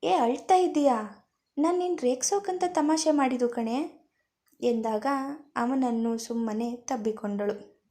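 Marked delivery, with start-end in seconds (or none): 0.0-4.1 higher and louder, suddenly worried; startled to see her crying; husband, alarmed, asking his wife why she is crying. A narrator's reading. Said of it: "ಆಗ ಗಾಬರಿಯಾದವನು".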